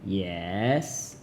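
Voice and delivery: "Yes" drawn out, dipping low then rising; teacher tone. Shown as a demonstration. Teacher demonstrating third tone, "Yes" soft, dipping then rising. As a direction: scoop "Yes" down and up, softly. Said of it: "teacher tone".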